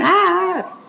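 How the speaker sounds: short high-pitched imitation of a panicked scream; imitated scream. Retelling the secretary coming in after the hit, imitated scream. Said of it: "the secretary coming in screaming".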